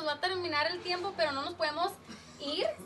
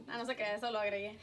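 ordinary talk

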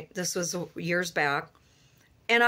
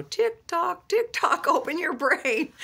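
mock ticking clock; 8 semitones higher than usual. Mocking TikTok as a clock.

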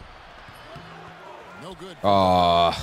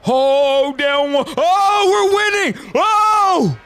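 very high, loud screaming; ecstatic shouting; 7 dB louder, much slower than usual. Real, not acted. Gamer on the bench seeing team take lead, ecstatic shouting. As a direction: scream in surprise, building as the lead flips.

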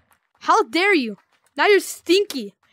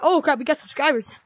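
ordinary talk

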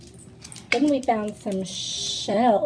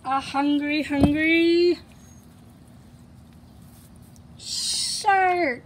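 dramatic; 4 semitones higher, slower than usual. Acted. Teacher dramatizes toy shark.

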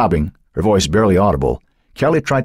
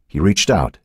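plain reading voice

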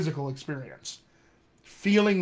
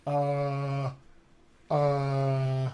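drawn-out General American thinking sound; General American hesitation; 3 semitones lower, 3 dB quieter, much slower than usual. Shown as a demonstration. Coach demonstrates his thinking sound, General American hesitation. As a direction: hold a relaxed-jaw General American "uh". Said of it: "that's my hesitation sound".

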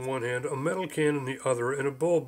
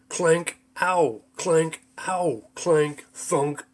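imitated clanks and ows; 2 semitones higher, 4 dB louder, much slower than usual. Trap clanking, someone yelping in pain.

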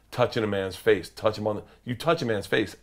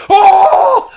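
delighted gasp; 14 dB louder than usual. Retold or imitated. Delighted reaction to Rachel's idea.

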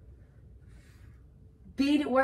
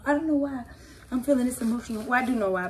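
usual speaking voice

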